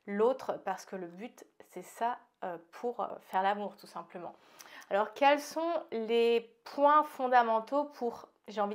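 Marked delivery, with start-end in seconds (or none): none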